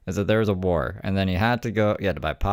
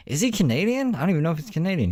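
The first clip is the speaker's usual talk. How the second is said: puzzled; 9 semitones higher, faster than usual. Real, not acted. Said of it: "puzzled self-question".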